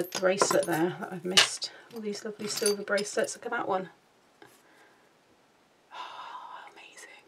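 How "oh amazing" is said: breathless little whisper of wonder; awed whisper. Real, not acted. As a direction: whisper it in awe. Whispering in awe over bracelet.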